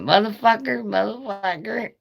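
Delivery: high parrot screech; squawking parrot. Retold or imitated. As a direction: squawk the cuss like a bird. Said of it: "that's what the bird was saying".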